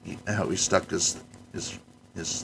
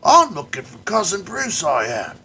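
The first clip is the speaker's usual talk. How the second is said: high, loud hammed-up Australian accent; Australian caricature; 9 semitones higher, 6 dB louder than usual. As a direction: play a cartoon Aussie wrestler. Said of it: "they sadly had to play it like a caricature".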